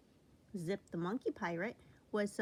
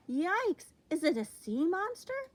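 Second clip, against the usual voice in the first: high, loud startled yelp; frightened cry; 8 semitones higher, 7 dB louder than usual. A narrator's reading. First mate to the crew, loud frightened cry. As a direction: shriek it out in fright. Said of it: "cried Zelda".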